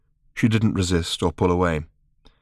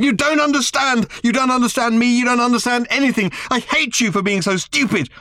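furious shouting. A narrator's reading. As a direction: scream your hatred at him.

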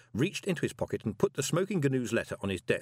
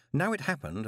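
usual speaking voice

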